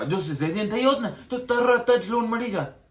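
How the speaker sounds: high-pitched mock regret; mimicked loan officer. Applicant imitating the officer's absurd caesarean excuse, mocking.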